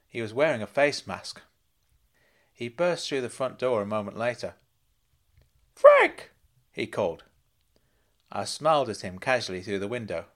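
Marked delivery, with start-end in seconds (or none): 5.7-6.3 put-on much higher, loud excited old uncle voice; high, loud eager uncle; Uncle Claude calling out. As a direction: squeal his name like a giddy fussing uncle.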